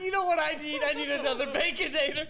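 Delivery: high laughing voice. Retold or imitated. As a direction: squeal it through laughter. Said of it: "He's like".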